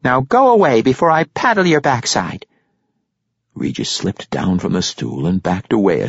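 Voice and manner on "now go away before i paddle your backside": high-pitched scolding threat; threatening. A narrator's reading. Barkeep stepping toward the halfling, threatening. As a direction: warn him off in a raised character voice. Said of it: "coming forward threateningly as she did".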